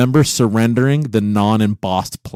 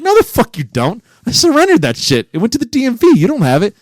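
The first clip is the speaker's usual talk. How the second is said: indignant retort; 12 semitones higher, 3 dB louder than usual. Retold or imitated. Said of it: "I'm like".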